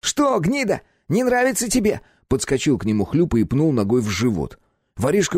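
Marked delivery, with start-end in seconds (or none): 0.0-2.0 angry taunt. A narrator's reading. Khlyupa kicking captive.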